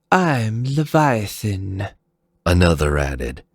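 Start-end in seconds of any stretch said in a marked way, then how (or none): 0.1-1.9 higher, slow and cold; cool female warrior. Acted. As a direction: say it icily, unhurried. Leviathan introduces herself.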